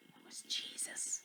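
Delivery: breathy secret whisper. A narrator's reading. Storyteller whispering the reveal to the children.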